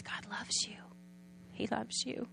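softly whispered, tender and close; a hushed, loving whisper; whispered comfort. Retold or imitated. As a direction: whisper it into her ear to comfort her. Giver comforting the crying mother.

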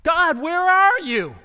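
loud, high, drawn-out cry; anguished calling out. Acted. Believer to God in the silence, pleading shout. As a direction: call out long and high into the silence.

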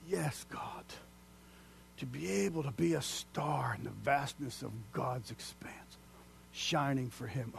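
hushed, breathless whisper of wonder; awed whisper. Real, not acted. Preacher whispering in awe to the congregation.

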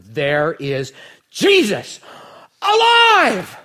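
joyful proclamation. Acted. Preacher revealing the risen Jesus to the congregation, triumphant shout. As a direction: announce the miracle with full force. Mary finds Jesus at tomb.

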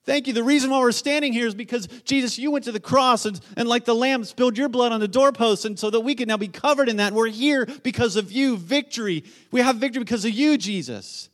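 high, loud, fervent outpouring; impassioned, rushing shouts of praise; yelling worshippers. Acted. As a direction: cry out thanks to God as an exultant crowd. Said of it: "They're yelling".

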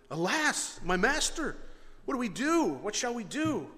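high, alarmed cry of dismay; freaking out. Acted. Servant to his master at the sight of an army, panicked. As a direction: plead in rising panic. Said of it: "The servant's freaking out".

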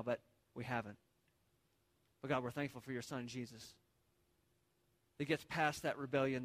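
low, soft prayer voice; bow your head and pray quietly; opening prayer. Real, not acted. Pastor praying for the congregation, low and soft.

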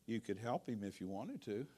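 very soft, low and gentle; the Lord gently nudging the tired pastor, soft and low; sweet and quiet. Retold or imitated. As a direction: hush it like a gentle nudge. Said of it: "very sweetly and quietly said".

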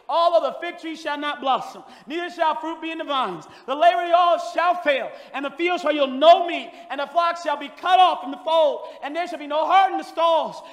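loud, high, ringing pulpit cry; fiery revival preaching; preaching at full force. Acted. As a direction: preach it out to the whole church. Preaching Habakkuk's prayer.

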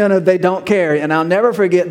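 normal talking voice

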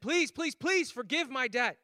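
high, loud, slow pleading debtor; begging. Retold or imitated. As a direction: beg the king desperately. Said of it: "he begged the king".

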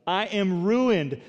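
crying out in woe. Acted. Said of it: "I cried".

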